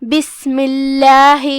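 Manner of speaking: بسم اللہ with a light, thin "lah"; ترقیق: keep اللہ in بسم اللہ thin and light; بسم اللہ recited slowly, thin lam; thin Allah. Shown as a demonstration. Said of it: "ہم اسے پتلی آواز میں پڑھیں گے".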